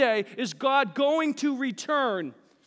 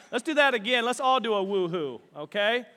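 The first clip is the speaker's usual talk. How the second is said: usual speaking voice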